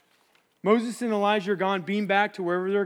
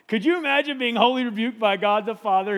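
ordinary talk, nothing marked

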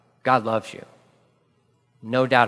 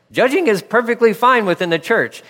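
higher, louder emphatic declaration; 8 semitones higher, 4 dB louder than usual. Real, not acted. Preacher correcting the laughing congregation, emphatic. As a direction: insist it's fine, punching judging.

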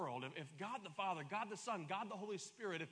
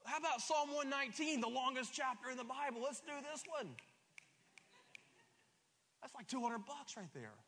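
normal talking voice

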